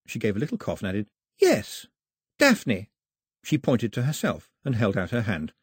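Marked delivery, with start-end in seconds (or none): 1.4-2.9 higher, firmer repeat of the name; girl repeating. A narrator's reading. Girl repeating her name to Mao, firmer. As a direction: say it again to be sure.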